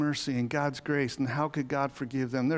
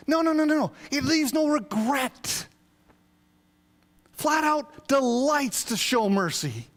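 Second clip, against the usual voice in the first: preaching at full force; 9 semitones higher, 4 dB louder than usual. Acted. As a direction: lift the verse up like a proclamation.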